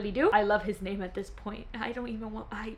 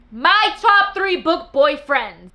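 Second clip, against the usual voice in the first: slow, higher and louder, top stressed; excited announcement; 4 semitones higher, 9 dB louder, slower than usual. Real, not acted. Introducing her top three to viewers, slow and grand. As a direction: announce it like a big reveal. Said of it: "announcing the list".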